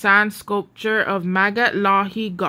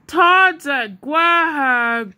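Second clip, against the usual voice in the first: calming; 5 semitones higher, 3 dB louder, slower than usual. A narrator's reading. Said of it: "Juan calmed her".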